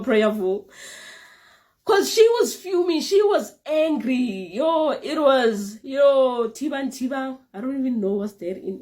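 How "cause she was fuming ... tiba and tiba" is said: laughing-crying. Real, not acted. Laughing while recounting.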